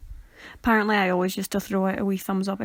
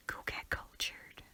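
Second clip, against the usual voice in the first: soft, slow whispered jab; hushed playful jab at viewers; 17 dB quieter, slower than usual. Real, not acted. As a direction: whisper it teasingly.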